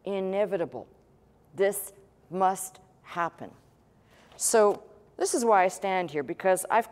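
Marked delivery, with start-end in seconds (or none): 1.5-3.5 slow, separate words: THIS. MUST. HAPPEN; emphatic MUST. Shown as a demonstration. Preacher driving home the inevitable to the congregation, slow and emphatic. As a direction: set "must" apart with deliberate weight. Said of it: "the emphasis on the word".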